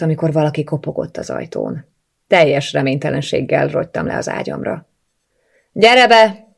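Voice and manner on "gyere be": high, loud call through the door. A narrator's reading. Sister calls out to whoever knocked at the door, calling out. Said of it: "szóltam ki".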